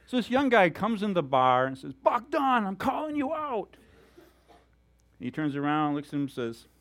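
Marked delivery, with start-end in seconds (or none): 1.9-3.8 high, raised-voice gunfighter challenge; cocky challenger. Retold or imitated. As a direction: call him out across the saloon. Young gunfighter challenges veteran in story.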